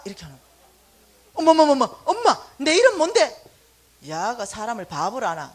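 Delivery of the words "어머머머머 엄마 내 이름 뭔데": much louder, higher startled gasp; astonished cry. Retold or imitated. Daughter astonished her mother wakes up lucid, loud and high. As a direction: gasp in disbelief.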